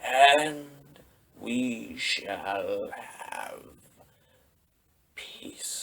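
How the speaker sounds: very slow, fading to a whisper on peace; ominous Palpatine. Retold or imitated. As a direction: let the threat sink into a hush. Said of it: "that great line".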